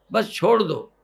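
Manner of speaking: ordinary delivery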